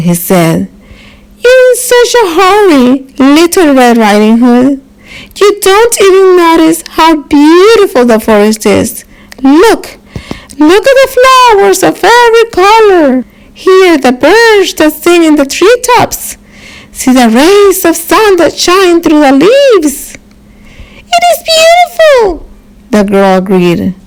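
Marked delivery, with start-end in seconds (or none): 1.4-20.0 high, fast, sweet-talking wolf; sly coaxing wolf. Acted. Wolf sweet-talks the girl into dawdling, coaxing. 21.1-22.5 put-on high sweet little girl voice; a small girl chirping up at the wolf. Little girl answers the wolf, high and sweet.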